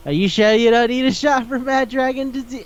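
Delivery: high-pitched call to someone leaving; mock calling after. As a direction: imitate hollering a concerned question after her.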